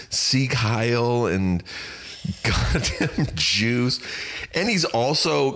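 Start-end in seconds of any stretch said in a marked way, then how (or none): 0.1-1.3 retold scream. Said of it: "he's fucking screaming". 2.4-4.0 slow, higher mock scream without the volume; imitated screaming. Telling how thin the apartment walls are, mock screaming. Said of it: "he's fucking screaming".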